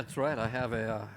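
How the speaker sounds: usual speaking voice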